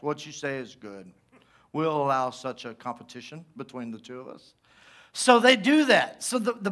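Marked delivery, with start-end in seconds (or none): 0.0-1.1 low, very soft, lame reply; mock the weak answer, barely voiced; terrible comeback. Preacher mocking the prophets' comeback, low feeble mumble. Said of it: "See the terrible comebacks". 1.7-4.5 low, hushed, feeble agreement; terrible comeback. Acted. Prophets accepting Elijah's contest, low lame mumble. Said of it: "See the terrible comebacks".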